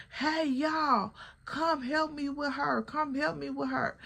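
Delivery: imitating a friend calling for help.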